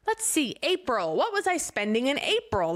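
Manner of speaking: sing-out musing, as if poring over receipts; mock bookkeeping. Imagining herself at the desk with receipts, mock bookkeeping.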